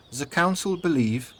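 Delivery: put-on high, slow German-accented voice; Gretel. A narrator's reading. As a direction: begin explaining in a soft German accent. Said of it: "in her soft German accent".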